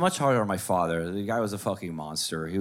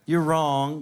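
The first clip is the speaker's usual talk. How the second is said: mock know-it-all retort; 7 semitones higher, 5 dB louder, much slower than usual. Shown as a demonstration. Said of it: "Don't say".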